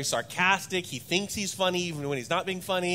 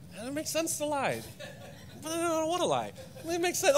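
put-on much higher, laughing kid's inner voice; tormented little voice; 8 semitones higher, 4 dB quieter than usual. Father voices his son's inner debate, high and laughing. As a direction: voice the boy's tiny conscience, higher and torn. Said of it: "angel and the devil on his shoulders".

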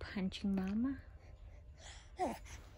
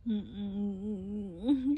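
ordinary talk, nothing marked

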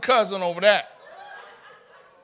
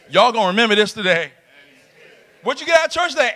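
ordinary delivery

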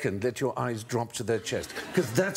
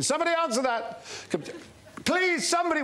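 high, frantic call through the house; shouting up the stairs for help. Retold or imitated. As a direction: yell for someone to answer the phone. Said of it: "So I was going".

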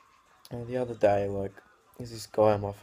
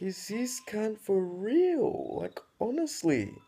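much higher, slow, whiny mock outrage; slow, hammed-up wounded whine; 11 semitones higher, 3 dB louder, slower than usual. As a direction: squeak it like an overdramatic victim. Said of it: "I'm like".

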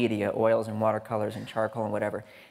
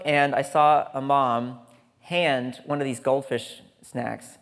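ordinary delivery

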